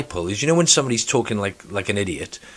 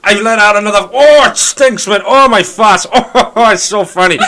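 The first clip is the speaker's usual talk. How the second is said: much higher and louder, laughing through it; mocking a shoeless coworker who thinks his farts are hilarious; mock smug coworker; 9 semitones higher, 10 dB louder, slower than usual. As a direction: camp it up like a giggling prankster. Mimicking farting coworker.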